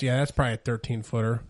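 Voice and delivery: imitating an unbothered uncle, low and casual; nonchalant. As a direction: toss it off like it's nothing. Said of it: "my uncle nonchalantly goes".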